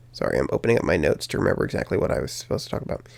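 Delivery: soft, fast, half-whispered apology; quiet aside. Real, not acted. Apologizing to viewers while opening notes, fast and whispered. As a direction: rush through it under your breath.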